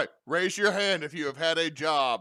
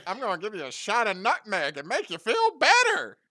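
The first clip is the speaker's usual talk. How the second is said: character voice; 8 semitones higher, 6 dB louder than usual. Acted. NPC offers nutmeg shot.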